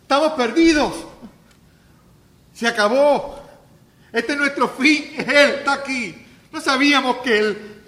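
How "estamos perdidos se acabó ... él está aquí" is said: higher, louder panicked cry; terrified demon shout. Acted. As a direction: scream it as doomed demons. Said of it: "gritan diciendo". (grito aterrado, más agudo y fuerte)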